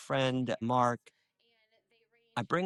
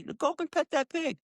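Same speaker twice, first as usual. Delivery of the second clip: urging; 13 semitones higher than usual. Retold or imitated. Urging a visitor.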